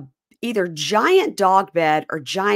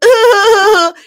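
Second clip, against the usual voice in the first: frustrated groan; 13 dB louder than usual. Retold or imitated. Sandoval's frustrated outburst, acted.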